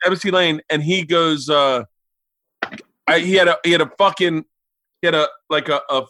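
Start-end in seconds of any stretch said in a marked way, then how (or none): none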